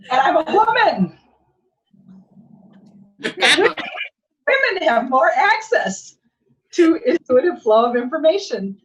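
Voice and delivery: loud, high, laughing proclamation; exuberant, over-emphatic claim with a laugh; emphatic boast. Retold or imitated. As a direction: proclaim it with comic self-importance. Mock boast of her special status.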